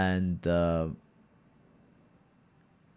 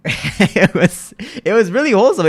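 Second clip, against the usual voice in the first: higher, louder, laughing gush; giggling about a sweet memory; 9 semitones higher, 7 dB louder than usual. Real, not acted. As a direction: burst out laughing fondly.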